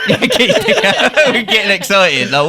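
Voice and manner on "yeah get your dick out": laughing while repeating the joke. Real, not acted. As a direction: say it through laughter.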